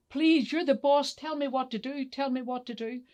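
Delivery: high-pitched, insistent begging; pleading elf. Acted. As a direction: plead with the boss for orders. Kringle begs his departing boss.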